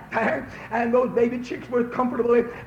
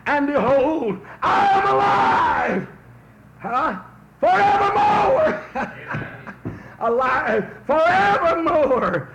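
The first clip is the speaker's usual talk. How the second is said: preached shout; 5 semitones higher, slower than usual. Acted. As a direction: ring it out from Revelation. Quoting Jesus with shouting.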